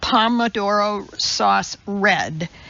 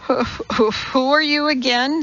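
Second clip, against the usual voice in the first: stammered, rising confusion; stammering; 4 semitones higher than usual. Acted. Walter stammering at the woman before him. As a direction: trip over the first words. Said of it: "stammered Walter".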